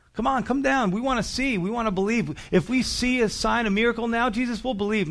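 high-pitched mock pleading; mocking crowd. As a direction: jeer like a crowd egging him on. Acts out the jeering crowd.

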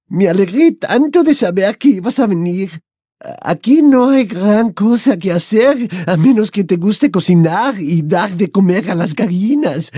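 put-on a delighted French girl; French accent.

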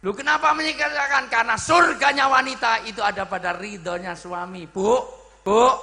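high, loud exhortation; full-force preaching. Real, not acted. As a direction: drive the point home to the whole congregation.

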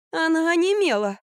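high, plaintive whine; complaining. A narrator's reading. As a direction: whine about your discomfort. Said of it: "пожаловалась Катя". (жалобно, высоким голосом)

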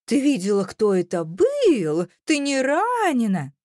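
high, stretched-out fake concern; squeaky mocking voice. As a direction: mimic his concern in a squeak, dragging vowels. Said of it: "песклявым голосом говорила я".

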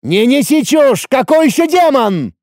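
high, angry yell; орать в ответ. A narrator's reading. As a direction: yell it back, dismissing him outright. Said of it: "орал в ответ Ацеф". (орать раздражённо и высоко)

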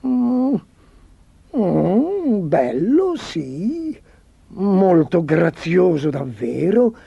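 slow, swooping hums of pretended appreciation; drawn-out, unconvincing admiration; feigned approval. A narrator's reading. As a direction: murmur praise hesitantly, with exaggerated up-and-down tune. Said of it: "mormorò".

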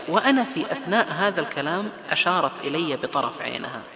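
ordinary delivery

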